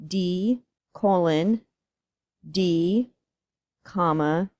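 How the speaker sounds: slow, clear symbol by symbol; dictating. Shown as a demonstration. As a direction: spell out each symbol for the class. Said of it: "the formula is kinda crazy. It is".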